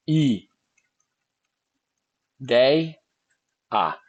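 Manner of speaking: each syllable said on its own, slowly. Shown as a demonstration. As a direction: split into syllables with long pauses between. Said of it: "É a mesma coisa daqui".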